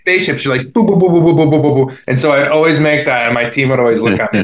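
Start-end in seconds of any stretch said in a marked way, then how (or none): 0.7-2.0 humming spaceship engine sound, higher and louder; spaceship noise. Shown as a demonstration. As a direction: hum like a spaceship landing. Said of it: "I'd make the spaceship noise".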